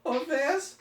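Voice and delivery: high, slow worried question; alarmed surprise. Acted. Elephant shocked, asking Piggy if the book ends. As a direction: gasp out the question.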